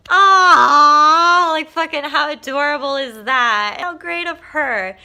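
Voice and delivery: high, loud, real melting aww; gushing aww. Real, not acted. Jin gushes to viewers about the little boy, loud aww. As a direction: melt over something adorable. Reacting to cute story.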